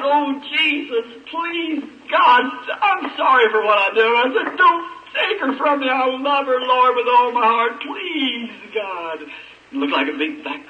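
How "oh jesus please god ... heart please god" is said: kneeling father pleading with God, high then fading; desperate prayer. Acted. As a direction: beg God not to take the child, voice straining high. Kneeling prayer for his dying child.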